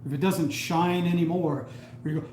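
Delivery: ordinary delivery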